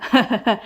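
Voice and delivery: short teasing chuckle; amused laugh. A narrator's reading. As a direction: laugh after calling out to her. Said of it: "وفارس وراها بيضحك". (ضحكة مشاكسة)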